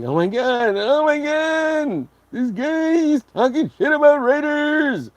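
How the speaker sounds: high, drawn-out mock gasp; high mock-offended whine; mocking outraged listener. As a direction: parody a shocked offended fan. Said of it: "they're like".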